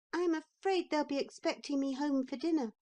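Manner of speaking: high, quick little boy pretending not to care; boy's defiant voice. A narrator's reading. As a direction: act brave as a small boy. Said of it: "he said".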